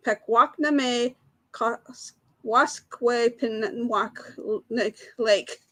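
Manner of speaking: very slow, broken-up "Pakwachname Koskwaskway Pinwanek"; halting pronunciation attempt. Real, not acted. Quiz host repeats the hard lake name for players, halting and very slow. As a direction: labour through each syllable with gaps between them. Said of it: "I don't think I can pronounce it".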